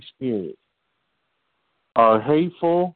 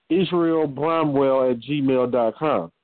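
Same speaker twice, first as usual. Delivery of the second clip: usual speaking voice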